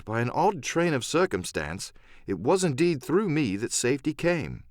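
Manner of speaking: ordinary delivery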